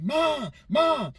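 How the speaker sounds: put-on high, loud child's cry for mom; a little boy yelling for his mama; hollering for mom. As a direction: call out for your mother like a scared kid. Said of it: "I hollered for my".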